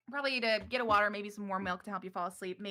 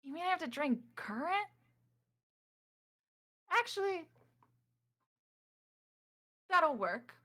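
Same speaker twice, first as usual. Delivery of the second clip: pitched well up, quizzical then pleased; a higher character voice musing aloud; Nikia's voice; 9 semitones higher than usual. Acted. Nikia realizing she must drink current, high and curious. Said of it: "And you heard Nikia go".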